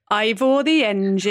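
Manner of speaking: Welsh catchphrase as a warm-up; Welsh accent. Shown as a demonstration. Getting into accents for the others, Welsh accent. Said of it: "Welsh, I sometimes have to go".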